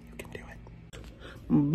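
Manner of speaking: slow, breathy whisper. Pretend. Cheering Don on in a slow whisper.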